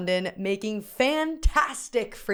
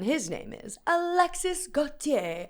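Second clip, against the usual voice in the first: name said with a light, self-mocking French accent; slightly higher, hammed-up French accent; terrible French accent; 5 semitones higher, 4 dB quieter than usual. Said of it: "excuse my terrible French accent".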